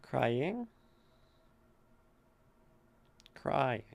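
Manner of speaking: "Crying" with CRY stressed. Shown as a demonstration. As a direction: scan the word. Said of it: "That feels like a trochee".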